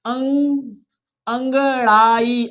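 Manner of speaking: अंगड़ाई lent, chaque syllabe détachée; découpe अंगड़ाई pour que la classe suive; lecture syllabée. Shown as a demonstration. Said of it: "हमारे साथ पढ़ना है आपको".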